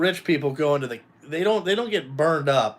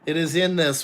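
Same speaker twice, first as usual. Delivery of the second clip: ordinary talk, nothing marked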